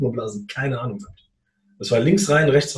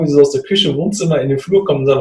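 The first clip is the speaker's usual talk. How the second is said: ordinary talk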